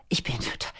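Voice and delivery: a hushed, breathless whisper, voice dropped right down. Retold or imitated. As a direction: whisper it as if holding the anger in. Mother voices her anger to son.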